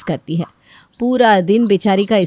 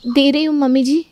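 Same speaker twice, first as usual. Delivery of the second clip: higher, dutiful reply; meek daughter-in-law; 6 semitones higher than usual. Acted. Daughter-in-law answering mother-in-law, meek.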